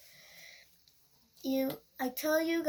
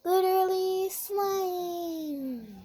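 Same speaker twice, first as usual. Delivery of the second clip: drawn-out amazed; 5 semitones higher, 8 dB louder, much slower than usual. Real, not acted. Kid amazed by slime.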